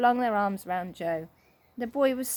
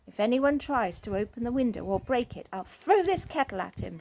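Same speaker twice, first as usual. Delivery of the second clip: ordinary delivery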